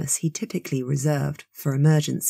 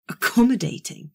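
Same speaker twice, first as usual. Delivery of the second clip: "Accommodating?" thrown back higher in indignant disbelief; spluttered; 5 semitones higher than usual. A narrator's reading. Theo outraged at the witch-hunt word, spluttering "Accommodating?". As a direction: sputter "Accommodating?" as an incredulous, raised-pitch question. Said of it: "Theo spluttered".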